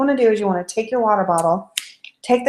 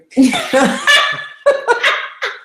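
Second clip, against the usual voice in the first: a high, gleeful giggle; 4 dB louder than usual. Real, not acted. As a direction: laugh out high and delighted. After "we're gonna spark you.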